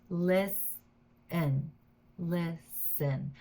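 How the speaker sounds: "Listen" split in two, LIS, pause, EN; syllable by syllable. Shown as a demonstration. Teacher showing learners the two syllables, split apart. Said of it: "This is two syllables".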